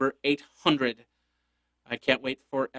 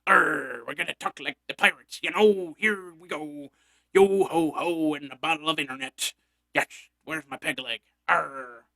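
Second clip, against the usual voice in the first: lightly voiced hammed-up pirate growl; a hint of a cartoon pirate, mostly his own voice. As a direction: play a jolly pirate for a bit, half joking. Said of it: "Talk Like a Pirate Day".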